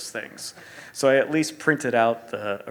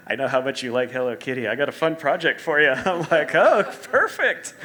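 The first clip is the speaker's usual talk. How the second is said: louder, rising, upbeat pitch; excited retelling; 4 semitones higher, 9 dB louder than usual. Retold or imitated. Designer retelling the Hello Kitty project offer, excited. Retold pitch and delighted reply.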